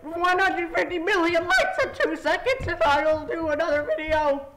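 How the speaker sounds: mock hyped YouTuber; YouTuber impression. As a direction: parody an overhyped creator. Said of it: "all these YouTubers are like".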